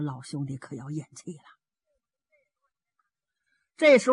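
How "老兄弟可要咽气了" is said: low, hushed aside to oneself; inward thought. A narrator's reading. Zhao Bi privately fears his brother is dying, hushed and low. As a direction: drop the voice for a private, grave thought. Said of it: "心说".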